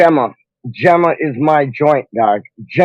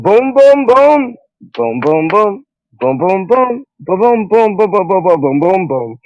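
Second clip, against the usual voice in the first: playful boom noises; 4 semitones higher, slower than usual. Pretend. Streamer reacting to viewers laughing, playful booming sounds. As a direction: bounce out a run of booms.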